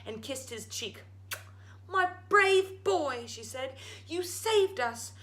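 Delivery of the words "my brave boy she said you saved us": high, slow, a touch tearful; tearful mother. Acted. Mother hugging her son, a touch tearful. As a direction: praise him through happy tears. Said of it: "tears came to her eyes".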